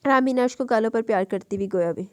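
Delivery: ordinary delivery, nothing marked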